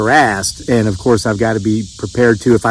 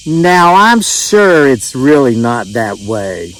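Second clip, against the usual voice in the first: slow Southern drawl, pitched up on now; mock-sweet Southern lady saying now; imitating a doubtful Southern woman; southern accent; much slower than usual. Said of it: "with that southern accent".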